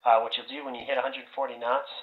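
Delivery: ordinary delivery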